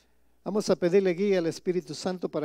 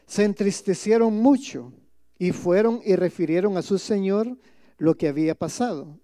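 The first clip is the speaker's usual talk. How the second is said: scripture reading; 6 dB louder than usual. Acted. Pastor reading the parable aloud to his congregation, solemn and loud. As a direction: proclaim the verse to the congregation.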